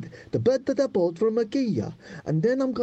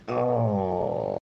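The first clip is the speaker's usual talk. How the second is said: ordinary delivery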